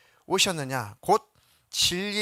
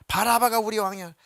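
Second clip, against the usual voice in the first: short, louder shout punching 바라바가; crowd shouting; 6 semitones higher, 6 dB louder than usual. Retold or imitated. Crowd insisting on Barabbas, 바라바가 shouted loud. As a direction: declare 바라바가 as king with force. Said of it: "막 소리를 질러 말하기를".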